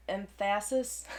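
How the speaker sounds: "emphasis" said em-FASS-is; "emphasis" with the FA punched instead of EM; wrong-syllable stress. Shown as a demonstration. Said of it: "I always get teased about which syllable".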